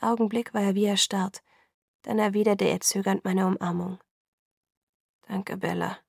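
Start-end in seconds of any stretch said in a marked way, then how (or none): 5.3-6.0 rough, husky thanks; hoarse voice. Acted. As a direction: voice gone rough with emotion. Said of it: "Seine Stimme war rauer als sonst".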